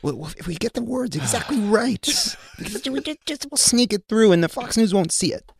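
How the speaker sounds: high, sighing mock voice of a naive planner; mock timid Democrat. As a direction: ham up a nervous plotter sneaking it through.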